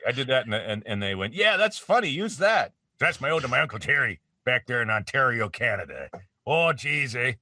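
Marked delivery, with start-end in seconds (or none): 1.3-2.7 high, loud enthusiastic approval; mock excitement. Producers approving the uncle voice, mock excitement. 3.0-7.3 folksy Ontario Canadian accent; Uncle Terry. Shown as a demonstration. Actor showing his uncle back in Ontario, Canadian accent. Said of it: "They all talk like that".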